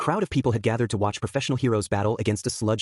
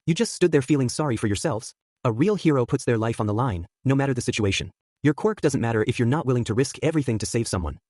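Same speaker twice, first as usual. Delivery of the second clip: ordinary delivery, nothing marked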